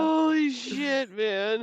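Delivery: high, drawn-out stunned exclamation; stunned reaction. Real, not acted. Listener reacting to the chicken's fate, stunned reaction. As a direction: stretch it out high in disbelief.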